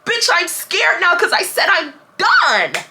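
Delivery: high, drawn-out exclamation; hammed-up high-pitched protest; mock outburst. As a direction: wail it slowly, stretching the final DONE. Mock outburst after acted yelling.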